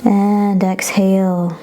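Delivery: long drawn-out and exhale; slow exhale cue. Shown as a demonstration. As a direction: draw it out with the breath. Breath cue in yoga class.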